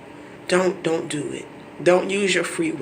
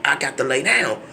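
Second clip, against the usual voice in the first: usual speaking voice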